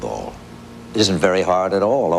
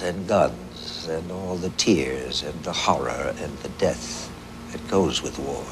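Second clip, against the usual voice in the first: somber recital; 4 semitones lower, 6 dB quieter than usual. Acted. Poem turns to horror and death.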